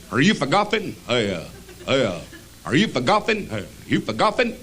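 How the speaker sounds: lightly voiced goofy Southern driver; a hint of a goober, repeating the question; goobery driver. Airport driver asking a traveler a question, goobery. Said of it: "kind of a goobery looking guy".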